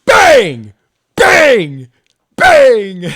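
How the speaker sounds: high, very loud sound-effect shouts; shouted bang. Pretend. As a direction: yell each bang like a gunshot. Slamming moves on the board.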